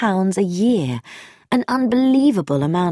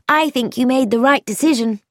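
higher-pitched, decided woman's voice; firm; 6 semitones higher than usual. A narrator's reading. Woman approves her friend's decision, firm higher voice. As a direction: state it with mild firmness. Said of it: "she said firmly".

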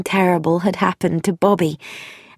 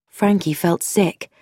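ordinary delivery, nothing marked

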